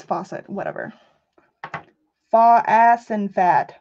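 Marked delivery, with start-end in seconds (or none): 2.3-3.7 "Fa ass and fat" split into slow separate syllables; sounding it out slowly. Shown as a demonstration. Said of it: "Is it not faucet?".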